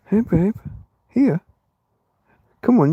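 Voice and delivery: cooing to the cat. Real, not acted. Calling her cat over, high coaxing coo. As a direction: coax the cat closer, pitched right up.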